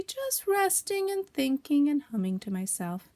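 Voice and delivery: high, slow, airy excuse; drawn-out feigned nonchalance; careless. Acted. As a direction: pretend you're idling quite happily. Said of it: "said Pooh carelessly".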